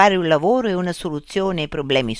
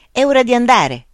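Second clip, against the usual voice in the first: higher, louder eager call; exclamation; 8 semitones higher, 6 dB louder than usual. A narrator's reading. Luca to his friend as they set off, exclaiming. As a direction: call it out to get going. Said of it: "esclamò Luca".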